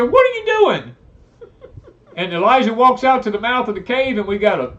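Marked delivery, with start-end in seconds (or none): 0.0-0.9 much higher, louder demand; God thundering down at a hiding prophet; God's booming voice. Retold or imitated. God confronting Elijah in the cave, booming and loud. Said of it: "God looked at him and said".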